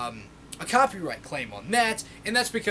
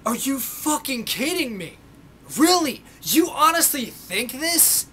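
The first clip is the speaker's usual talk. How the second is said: much higher and louder, slowed disbelief; incredulous; 6 semitones higher, 4 dB louder, slower than usual. Retold or imitated. Reacting to commenters on men's rights, loud incredulous disbelief. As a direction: react like you can't believe what you read. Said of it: "you're just like".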